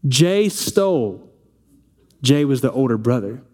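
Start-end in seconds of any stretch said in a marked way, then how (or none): 0.1-1.3 put-on slow, sing-song little girl tattle; little girl tattling. Little girl tells Dad on her brother, slow tattling voice. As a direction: drag it out like a small girl telling on brother. Daughter tattling in retold scene.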